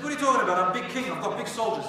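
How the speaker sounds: loud, high, scoffing brag; boastful king. Acted. King of Yemen scoffs at the scholars, loud boastful brag. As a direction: wave off their worry with pride.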